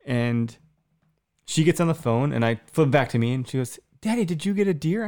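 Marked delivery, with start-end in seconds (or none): 4.0-5.0 put-on high eager child voice; little girl asking. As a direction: pitch up like a toddler asking daddy. Three-year-old daughter on the phone.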